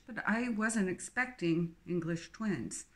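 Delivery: plain reading voice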